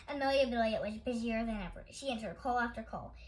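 usual speaking voice

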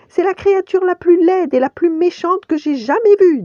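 high, loud frightened rabbit; lapin effrayé. A narrator's reading. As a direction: blurt it out high and loud in alarm. Pampan effrayé s'exclame devant l'ours. (petite voix aiguë et affolée)

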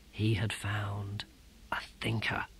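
hushed, breathless whisper. A narrator's reading. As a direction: breathe it like a discovery. Awed realization in narration.

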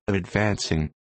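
plain reading voice

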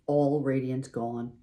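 ordinary delivery, nothing marked

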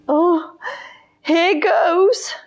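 high, timid gulp before facing the lion; nervous child. Acted. William talking himself into approaching the lion, nervous and high. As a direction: brave it out in a small voice.

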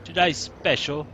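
lightly put-on British shopkeeper voice, slowed on the chips; bad British accent. Gamer reading the pub menu in a mock British accent. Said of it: "My uninspired, bad British accent".

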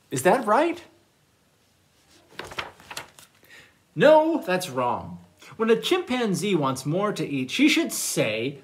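animated storytime narration with big swings in pitch; reading to children. Acted. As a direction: read a playful picture book to little listeners. Reads manners story to children.

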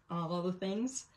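usual speaking voice

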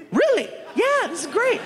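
high, excited squeal of disbelief; thrilled surprise. Retold or imitated. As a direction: burst out overjoyed at the news. Told he has the part.

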